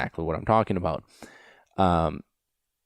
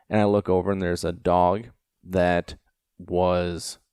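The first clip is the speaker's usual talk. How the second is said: ordinary talk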